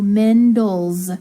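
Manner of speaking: Mendel's drawn out with a buzzing z at the end; Mendel's slow and loud, final z sound. Shown as a demonstration. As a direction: stretch Mendel's loudly, ending in z. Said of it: "it's gonna make a Z sound".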